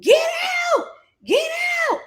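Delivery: retold yell, get out; screaming at the man to leave. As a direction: yell him out the door. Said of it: "I'm screaming at the top of my lungs".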